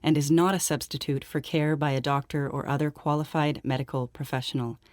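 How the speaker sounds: soft, even legal-notice read; disclaimer read. Acted. Host reads listeners the show's medical disclaimer, soft formal read. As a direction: read it out quietly and evenly, like small print.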